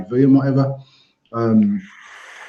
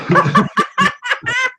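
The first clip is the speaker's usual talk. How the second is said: sudden high-pitched laugh; real high cackle of laughter; high burst of laughter. Real, not acted. Laughing at own story.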